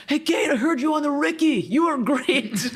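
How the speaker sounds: high, goofy guy voice; imitating neighbor. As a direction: do a silly high buddy voice. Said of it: "my neighbor Jeff was like".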